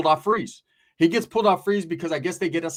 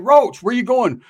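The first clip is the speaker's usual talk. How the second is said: louder, higher, drawn-out call; 6 semitones higher, 8 dB louder, slower than usual. Retold or imitated. Friend following Roach to the backyard, calling out slowly. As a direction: shout after him, stretching the words.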